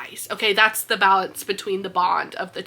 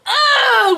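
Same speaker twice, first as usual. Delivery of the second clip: disgusted groan; 6 dB louder than usual. Real, not acted. Disgust after shouting name.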